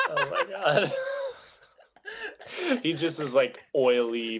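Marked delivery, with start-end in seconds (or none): 0.0-2.8 laughing. Real, not acted. Laughing at puberty bit.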